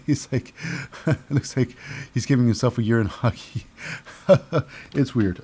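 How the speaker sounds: chuckling while talking; laughing. Real, not acted. Reviewer amused by a silly wrestling move, chuckling. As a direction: laugh softly through the words.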